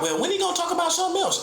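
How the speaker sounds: very high, fast whiny mock voice; mocking a lame. Mimicking a lame who wants the topic over, high and whiny. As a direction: squeak it out like an impatient complainer.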